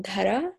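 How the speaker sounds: "Dara" begun with a DH, tapped r; DH sound. Shown as a demonstration. Guest teaching the host her name, DH sound. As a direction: say "Dara" with an Indian dh at the start. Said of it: "D H".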